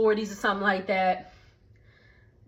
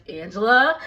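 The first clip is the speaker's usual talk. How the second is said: louder, laughing Angela; laughing call; 4 dB louder than usual. Real, not acted. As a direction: call her name through a laugh.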